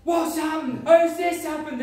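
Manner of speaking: put-on the stunned teenagers blurting it out; freaked-out friends. The girl's friends reacting to the healing, in a high, astonished, mock freaked-out voice. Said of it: "freaked out".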